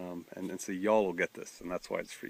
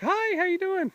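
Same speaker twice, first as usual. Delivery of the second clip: much higher, friendly mock greeting; young woman's voice; 7 dB louder than usual. Young woman greeting the pastor, imitated high and friendly. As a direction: lightly imitate a cheerful college girl.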